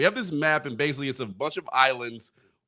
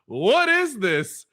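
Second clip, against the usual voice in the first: baffled teacher; 8 semitones higher, 3 dB louder, much slower than usual. Retold or imitated. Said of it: "was like".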